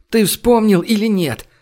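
high, heated demand for an answer; angry outburst. A narrator's reading. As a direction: snap the question in exasperation. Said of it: "взорвалась Женя". (гневно требовать ответа, высоким голосом)

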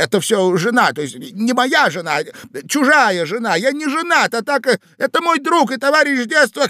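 flustered high pleading, mildly tearful; Иван Андреевич. A narrator's reading. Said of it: "рыдая и всхлипывая". (сбивчивое плаксивое оправдание)

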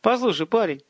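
high, pleading appeal; tearful high entreaty; nearly crying. A narrator's reading. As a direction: plead on the verge of tears. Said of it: "тот уже почти плачет".

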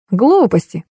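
mild dismissive snort. A narrator's reading. Colonel dismisses the story about him, mildly snorting. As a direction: brush it off with understated scorn. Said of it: "фыркнул Александр Михайлович".

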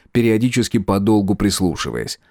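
ordinary delivery, nothing marked

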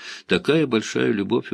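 ordinary delivery, nothing marked

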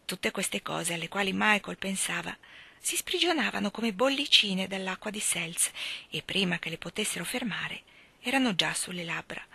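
usual speaking voice

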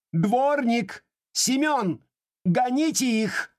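very high, slow shrill furious call; furious shout. Acted. Enraged lady shouting for the janitor to throw them out. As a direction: shriek for the janitor in rage. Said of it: "закричала с искажённым от гнева лицом".

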